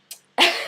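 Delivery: loud, high laugh; burst of laughter. Real, not acted. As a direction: burst out laughing mid-story. Laughs at her own retelling.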